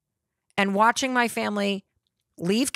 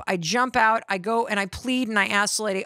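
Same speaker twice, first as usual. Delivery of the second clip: usual speaking voice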